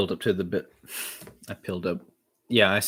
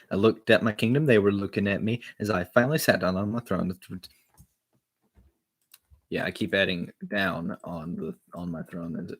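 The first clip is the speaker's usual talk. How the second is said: ordinary delivery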